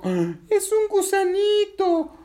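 gasp, then high loud squeal; high, drawn-out excitement; delighted discovery. Acted. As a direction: squeal slowly over a cute find. Patinina delighted by a caterpillar.

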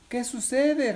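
high character voice; high-pitched storybook question; puzzled Patinina. Acted. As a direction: ask in a high little voice. Patinina questions her friend.

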